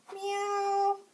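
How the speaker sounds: high, loud drawn meow; cat meow. Shown as a demonstration. Teacher showing children the cat's sound, high meow. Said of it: "and the cat says".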